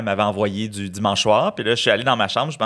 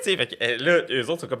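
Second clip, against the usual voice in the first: usual speaking voice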